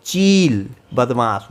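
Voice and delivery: plain reading voice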